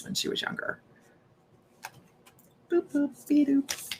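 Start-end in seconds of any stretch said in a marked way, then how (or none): 2.7-3.9 high, soft cartoon catchphrase; Betty Boop. Medium echoing a grandmother's sign-off to herself, soft imitated catchphrase. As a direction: toss it out small, high and light. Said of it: "an old reference to Betty Boop".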